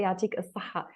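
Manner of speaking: "Yatik al-saha" in Tunisian Arabic. Shown as a demonstration. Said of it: "in the Tunisian dialect, we say".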